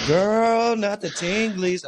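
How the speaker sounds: put-on high, drawn-out teasing "girl"; mock girlfriend squeal. Girlfriend reacting to bedroom gossip, mock excitement.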